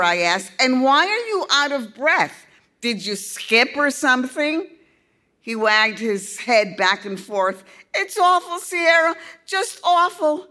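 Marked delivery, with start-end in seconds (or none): 0.6-4.7 high, loud playful teasing. A narrator's reading. Girl teasing the breathless boy. 7.9-10.5 put-on high, loud upset young boy; a boy wailing with dismay; distraught boy. A narrator's reading.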